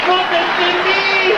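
very high, slow echo voice; high, stretched-out sung-like reply; echo answering. Acted. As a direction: call back as the echo. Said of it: "Y le dijo el eco".

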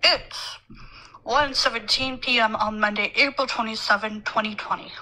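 talking around a lollipop in mouth; words rounded by candy in the mouth; sucking a lollipop. Real, not acted. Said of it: "And loud chewing, sorry. Lollipop".

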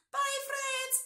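very high, loud puppet hedgehog; high-pitched hedgehog puppet farewell; Sammy. Pretend. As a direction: squeak a goodbye as a puppet animal. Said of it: "Sammy, can you say bye?".

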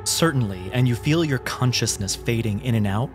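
normal talking voice

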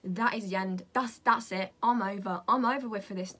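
loud, final declaration; emphatic giving up. Real, not acted. Vlogger ending this makeup look, loud and done with it. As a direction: declare it over, loudly.

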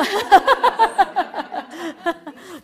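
loud hearty laugh; long laugh. Real, not acted. Teacher laughing at her own aggravation tea joke, loud hearty laugh. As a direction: let the laugh roll out.

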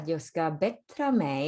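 jag ska bättra mig spelled out slowly, word by word; slow it right down for the learners; slow demo. Shown as a demonstration. Teacher to students, a slow clear demo. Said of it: "เดี๋ยวฟังเสียง".

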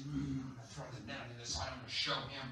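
low, quiet grumble under the breath; grudgingly comply, talking to yourself; acted sulky muttering; grumbling. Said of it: "he's still grumbling".

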